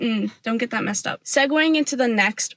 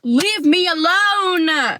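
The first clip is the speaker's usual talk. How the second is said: high, drawn-out mock wail of annoyance; slow, high cry of protest at a pestering app; exasperated outburst; 6 semitones higher, 3 dB louder, much slower than usual. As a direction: stretch it out in hammed-up exasperation.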